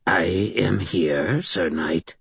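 slow, otherworldly cat-spirit voice; alien voice. Acted. The Malk answering Harry's summons, slow alien voice. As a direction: speak slowly like something not human. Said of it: "the Malk's alien voice said".

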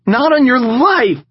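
high, loud, slow laughing no; cackling refusal. A narrator's reading. Marie turning down Knox's joke, high loud cackle. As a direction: cackle it out gleefully. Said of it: "she cackled".

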